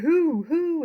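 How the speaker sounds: loud, high hooting; owl hoot. Acted. The outraged owl hoots at the noise, loud hoot. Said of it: "outraged the owl who hovered".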